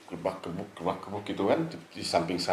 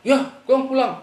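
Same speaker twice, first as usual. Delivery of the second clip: high and loud curt reply; snapping; 8 dB louder than usual. Retold or imitated. Friend snaps back when told to go home, loud and high. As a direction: spit it out in irritation.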